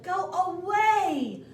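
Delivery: a dragon bellowing her off; dragon roar. A narrator's reading. Dragon shouting from the cave door at the princess, slow and loud. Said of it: "The dragon stuck out his nose out of the door and said".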